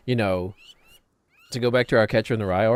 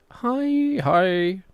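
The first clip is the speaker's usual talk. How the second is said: very high, gushing fake hi; mock someone overdoing a hello; oversold greeting; 10 semitones higher than usual. Imitating phony acquaintance met in passing, oversold high greeting. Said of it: "you're overselling it a bit much".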